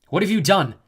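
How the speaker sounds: sharp demand of the snow goddess. A narrator's reading. Jason confronting the snow goddess, demanding loudly. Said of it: "Jason demanded".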